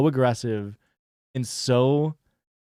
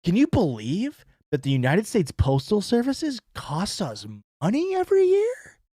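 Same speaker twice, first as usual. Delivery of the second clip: much higher mock-outraged question; sarcastic disbelief; 7 semitones higher than usual. Streamer sarcastically mocking tax complaints, high mock disbelief. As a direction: feign shock at paying for the post.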